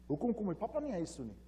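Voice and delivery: put-on high-pitched child's question in Afrikaans; little girl. Daughter asking the manager about her father, lightly voiced little girl. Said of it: "this little girl looked at Mister Drummond and says".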